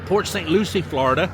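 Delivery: ordinary talk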